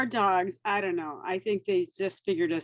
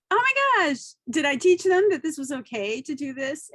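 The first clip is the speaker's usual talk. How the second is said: exasperated mom; 6 semitones higher, 3 dB louder than usual. Retold or imitated.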